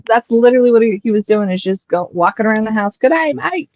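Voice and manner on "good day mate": a hint of a high Aussie greeting; lightly voiced Aussie toy; Australian accent. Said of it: "Is he Australian?".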